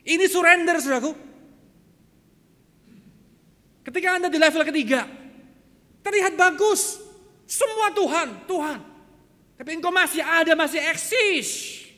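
loud, high preacher's cry; full-force preaching. Real, not acted. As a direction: preach it out forcefully at the top of your range. (khotbah lantang berapi-api)

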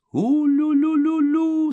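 very high, loud, drawn-out ululation; spaceship's farewell whoop. A narrator's reading. Spaceship says goodbye as it leaves, high loud warble. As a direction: hoot it slowly like a craft taking off. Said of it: "сказал корабль на прощание".

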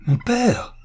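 «Mon père!» lancé beaucoup plus haut; crie-le, bouleversé; cri. A narrator's reading. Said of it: "s'écria Pascal". (cri aigu de détresse)

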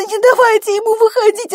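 very high, loud desperate wail; screaming in pain. A narrator's reading. Labouring Bella begging to hold the baby back, screaming in pain. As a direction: plead in a sustained shriek of agony. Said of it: "закричала от боли".

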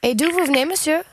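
put-on English accent. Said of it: "avec un accent british".

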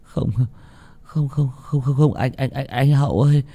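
high, stammering frightened pleading; delirious mumbling. A narrator's reading. Boy coming round begs spirits to spare him, delirious mumbling. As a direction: stutter the words, rising into a drawn-out, terrified plea. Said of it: "thằng Tèo cũng dần tỉnh lại lẩm bẩm".

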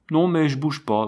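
a wounded man's understated, fearful protest; stifled, terrified voice. A narrator's reading. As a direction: answer with a touch of panic. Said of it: "une voix étouffée et terrorisée".

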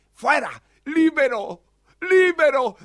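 loud, high, crying out free; tearful joy. Acted. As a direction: break into tearful triumph. Freed from prison, overwhelmed.

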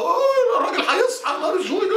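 high, laughing mock alarm; mock panic. Cinema crowd fearing the father wakes, mock panic. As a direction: squeal like a frightened moviegoer.

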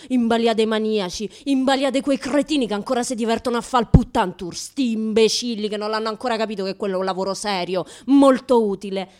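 loud indignant tirade; indignant rant. Acted. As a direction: rail against the idiots. Ranting at clients and idiots.

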